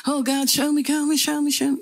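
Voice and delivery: high, urgent, repeated begging; worked-up pleading. Retold or imitated. Anxious young believer begging God for a sign, worked-up pleading. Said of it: "getting all worked up".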